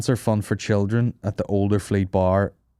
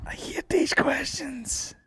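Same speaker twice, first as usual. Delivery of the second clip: breathy whisper, pitched up and slow; whispered aside; 4 dB quieter, slower than usual. Real, not acted. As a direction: mutter it under your breath like a groaning aside. Groaning aside during a quiz.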